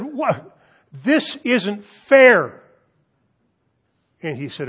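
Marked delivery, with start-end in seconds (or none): 0.0-2.6 high, loud outraged cry; horrified students yelling that it isn't fair. Retold or imitated. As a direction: shout the protest in disbelief. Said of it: "They were horrified".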